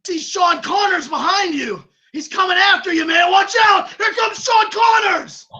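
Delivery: urgent warning. Acted. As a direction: warn him the enemy is coming. Acted warning of an attacker.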